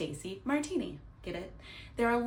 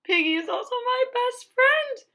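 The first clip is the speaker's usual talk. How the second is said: high, louder wail of a sob; acted crying; 11 semitones higher, 8 dB louder than usual. Gerald telling Doctor Cat about Piggy, crying. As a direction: wail it like a heartbroken child.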